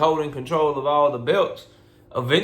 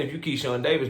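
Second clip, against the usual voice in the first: ordinary delivery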